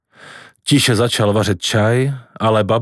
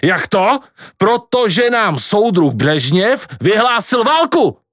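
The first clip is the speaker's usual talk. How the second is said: agitated rant; 9 semitones higher, slower than usual. A narrator's reading. Grandpa ranting about war.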